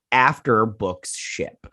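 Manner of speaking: ordinary talk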